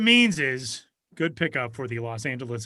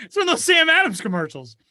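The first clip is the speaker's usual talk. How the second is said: excited shout; 12 semitones higher than usual. Acted. Boston bit continues.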